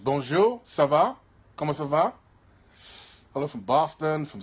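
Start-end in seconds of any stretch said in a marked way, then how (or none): 0.0-2.1 French with a lifted, questioning tune. Shown as a demonstration. Answering a viewer's request to say something, in French. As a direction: say it in French, rising at the end. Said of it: "Can you say something in French".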